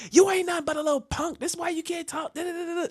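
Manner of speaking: put-on high-pitched taunting girl; trash talk. Girl taunts him after smacking him, high-pitched trash talk. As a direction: rattle it off high, imitating a trash-talker. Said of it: "she's talking trash too. She's like".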